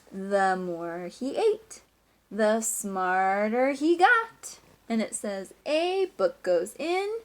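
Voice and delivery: slow, emphatic storybook reading; reading to children. A narrator's reading. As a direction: build up the best bit for the class.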